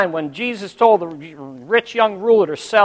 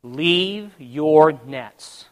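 very slow, drawn-out words; solemn command; 2 semitones lower, much slower than usual. Retold or imitated. Preacher repeating God's command, slow and weighty. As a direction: stretch each word out deliberately.

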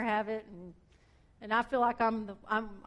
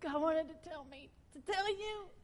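much softer, higher, gentle close; tender message; 7 semitones higher, 7 dB quieter than usual. Real, not acted. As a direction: hush down and lift into it tenderly. Closing message delivered tenderly.

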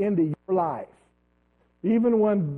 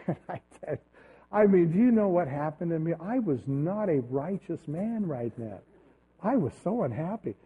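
ordinary talk

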